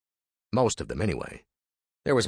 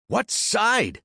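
higher, demanding; demanding, voice rising; 13 semitones higher than usual. Acted. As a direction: push the question up hard. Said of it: "Van demanded, his voice rising".

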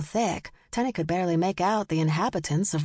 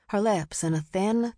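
ordinary delivery, nothing marked

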